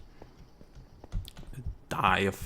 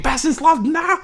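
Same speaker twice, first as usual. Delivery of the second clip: much higher, louder mock shout; mock viewer yell; 8 dB louder, slower than usual. Imagined viewer yelling at the player, mock shout. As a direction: play an exasperated viewer yelling. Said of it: "yelled at the screen".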